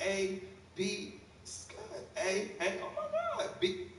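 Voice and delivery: mock mother reading grades, voice jumping up; hammed-up mom reaction; mom reading the card. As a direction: read it out like a doubtful mom.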